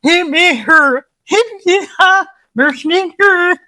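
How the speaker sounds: high, loud Muppet Swedish Chef gibberish; mock Swedish Chef, hammed-up and squeaky. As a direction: play the Muppet chef singing nonsense. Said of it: "Swedish from the Muppets".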